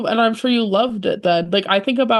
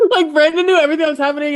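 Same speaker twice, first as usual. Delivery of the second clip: excited gush; 8 semitones higher, 4 dB louder, much faster than usual. Real, not acted. Excited praise of manager.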